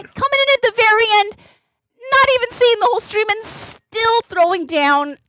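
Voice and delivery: high, excited praise; amazed gratitude. Real, not acted. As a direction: marvel at the late gift, high and eager. Gushing over a late donation.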